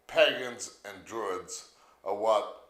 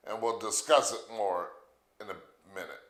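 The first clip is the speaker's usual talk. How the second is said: ordinary delivery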